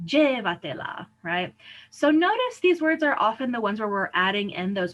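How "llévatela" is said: "llévatela" as LLE-va-te-la; stress far back. Shown as a demonstration. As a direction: hit the first syllable of "llévatela". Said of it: "emphasize the fourth".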